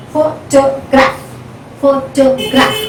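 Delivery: Photograph slowly split into pho-to-graph; syllable by syllable. Shown as a demonstration. Teacher dividing the word for students, Photograph in separate syllables. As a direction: give each syllable of Photograph its own beat. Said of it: "Now the first syllable".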